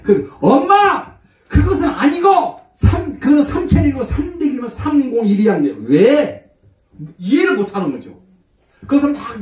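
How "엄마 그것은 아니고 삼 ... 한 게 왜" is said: high-pitched retold protest to Mom; exasperated son. Son correcting mother's written numbers, exasperated. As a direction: act out a son correcting his mother, voice jumping up.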